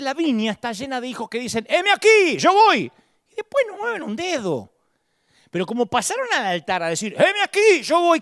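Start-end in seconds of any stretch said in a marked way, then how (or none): 1.7-2.9 high, loud, overeager "heme aquí"; mock eager promise. Church members at the altar, loud mock eagerness. 7.1-8.2 high, loud, overeager "heme aquí"; mock eager promise. Church members at the altar, loud mock eagerness.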